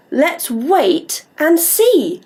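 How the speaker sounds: slow, drawn-out; slow sing-song. Shown as a demonstration. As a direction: stretch each word for the child to copy. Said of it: "I'd like you to say".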